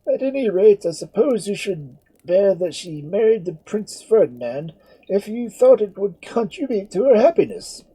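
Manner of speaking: high, loud, measured priestly voice; a stern priest laying out the terms; priest's voice. Acted. Priest speaks to Edmund.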